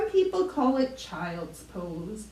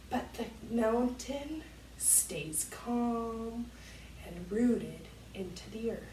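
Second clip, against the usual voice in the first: much softer, slow soothing voice; hushed guided meditation for kids; calm guided yoga; 3 semitones lower, 7 dB quieter than usual. Acted. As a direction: settle them like a still mountain. Calm mountain pose guidance.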